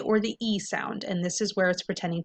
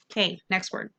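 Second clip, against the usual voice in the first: ordinary talk, nothing marked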